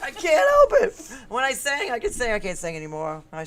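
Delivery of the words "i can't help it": loud, high, laughing wail; drawn-out squealed excuse, laughing; laughing protest. Real, not acted. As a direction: protest helplessly through laughter. Laughing defence of her singing.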